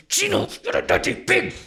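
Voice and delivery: loud mock insult with real force; vigorous insult. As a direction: throw the insult out with full vigor. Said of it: "say it with the same vigor".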